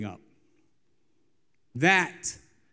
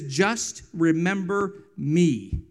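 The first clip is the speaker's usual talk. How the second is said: slow plea; much slower than usual. Retold or imitated. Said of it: "and he says".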